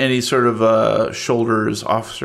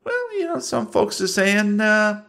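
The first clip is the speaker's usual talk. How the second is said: Barfellow voice; 7 semitones higher than usual. Acted.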